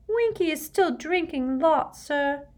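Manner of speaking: high elf voice, saddened; sad squeaky elf. A narrator's reading. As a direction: squeak it sorrowfully. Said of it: "he said sadly".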